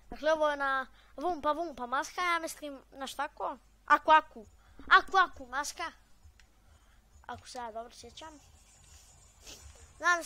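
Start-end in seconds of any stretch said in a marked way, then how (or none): 3.9-5.9 excited naming. Real, not acted. Naming the game mask excitedly.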